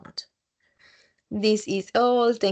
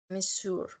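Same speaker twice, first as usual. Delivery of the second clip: usual speaking voice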